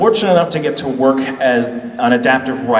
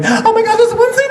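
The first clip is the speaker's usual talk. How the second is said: excited squeal. Retold or imitated. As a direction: squeal like a giddy fan. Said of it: "Patrick is like".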